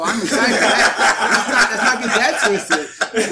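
co-host laughs while insisting he is excited; laughing protest. Real, not acted. Laughing through his protest.

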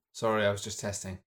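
ordinary delivery

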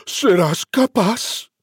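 chillido agudo y lento de cerdito; alarmed piglet squeal. Acted. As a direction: chilla incrédulo como un cerdito. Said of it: "chilló". (cerdito chilla alarmado ante la amenaza del lobo)

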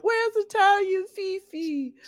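high, slow puzzled asking; drawn-out high mock question; mock confused customer. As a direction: play a lost customer asking for the place. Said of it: "people gonna be like".

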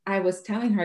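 ordinary talk, nothing marked

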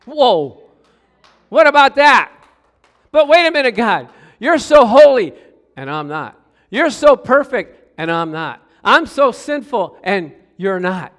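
loud, high protest to God, slowing at the end; pleading protest. Retold or imitated. Sinner protesting his unworthiness to God, loud and high. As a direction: plead your unworthiness loudly.

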